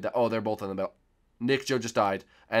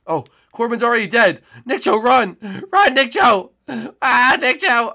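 loud, high calls to a friend; urgent shouting; 12 semitones higher, 11 dB louder than usual. Real, not acted. Player screaming at teammate Nick Cho to run, loud and urgent. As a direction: yell at him to run for his life. Friend being chased in game.

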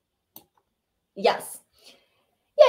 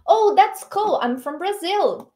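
louder, higher friendly line; model reading; 7 semitones higher, 4 dB louder, slower than usual. Shown as a demonstration. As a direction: voice Alex brightly for learners. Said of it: "ele responde".

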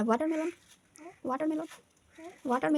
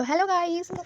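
higher, louder cheerful "hello guys" prompt; vlogger greeting; 6 semitones higher, 6 dB louder than usual. Shown as a demonstration. Mother prompting her son to greet the viewers. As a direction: model the greeting for the child. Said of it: "বলো".

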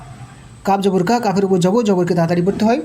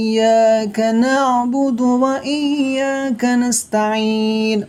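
slow, higher melodic chanting of the verse; Quran recitation; 3 semitones higher, much slower than usual. Shown as a demonstration. Teacher recites the whole verse for students, slow melodic chant. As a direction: draw each word out in a sung recitation tone. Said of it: "তাহলে পুরো আয়াতটি কেমন হবে?".

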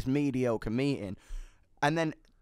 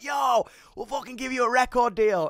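A&R guys shouting an eager offer, higher and louder; excited shout; 10 semitones higher, 5 dB louder than usual. Retold or imitated. Eager A&Rs shouting a deal offer at the band backstage, excited shout. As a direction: hype it up like a label rep desperate to sign. A&Rs crowding the toilets.

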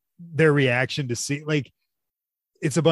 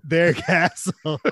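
slow, higher mock movie line with a laugh; Feldman; 5 semitones higher, 3 dB louder, much slower than usual. Echoing a friend's movie quote, drawn out and laughing. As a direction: stretch it out, hammy and amused. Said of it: "And he goes".

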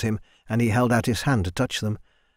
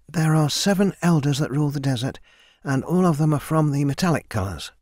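higher, eager recitation of memorized facts; young Mina reciting; 4 semitones higher than usual. Acted. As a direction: rattle off the lesson like a dutiful student. Mina recites lesson to Copper.

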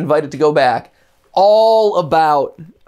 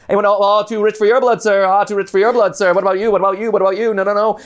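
higher, fast auction patter; auctioneer; 5 semitones higher than usual. Shown as a demonstration. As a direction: rattle off bids like an auctioneer. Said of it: "Can you imitate an auctioneer?".

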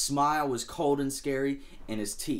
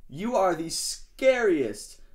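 slow, high adoring line; awestruck; 6 semitones higher, 5 dB louder, slower than usual. Acted. Shark smitten with Sadie, slow adoring praise. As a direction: swoon over her.

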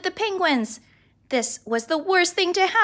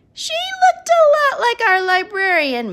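louder, slow and high for the big reveal; dramatic reveal; 10 semitones higher, 8 dB louder, slower than usual. Acted. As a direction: land the twist slowly.

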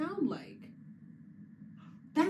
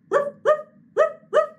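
much higher, much louder ruff ruff; dog barks; 14 dB louder than usual. Pretend. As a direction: yap like a small dog. Teacher voices the dog.

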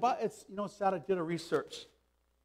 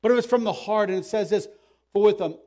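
loud, raised-pitch sermon delivery; forceful preaching; 4 semitones higher, 8 dB louder than usual. Real, not acted. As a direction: hammer the message home to the congregation. Preaches the passage at full force.

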